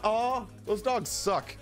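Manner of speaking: ordinary talk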